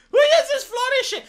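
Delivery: exasperated squeal. Real, not acted. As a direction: squeak it out in disbelief.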